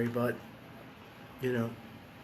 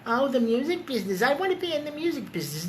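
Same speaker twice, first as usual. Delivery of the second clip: mocking wannabe; 7 semitones higher, 4 dB quieter, faster than usual.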